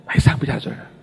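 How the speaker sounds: breathy whisper with no voice at all; voiceless whisper. Retold or imitated. Pastor who lost his voice, whispering to the congregation. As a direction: whisper it as if your voice is gone. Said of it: "saya nggak ada suaranya itu".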